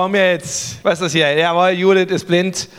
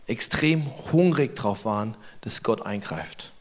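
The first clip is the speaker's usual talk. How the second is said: softer, slow, earnest; hushed emphasis; 8 semitones lower, 8 dB quieter than usual. Real, not acted. Preacher driving home the sermon's point, hushed. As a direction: lean in and say it softly.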